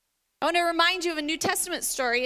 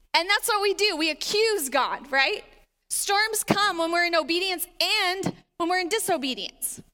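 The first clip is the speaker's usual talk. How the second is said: usual speaking voice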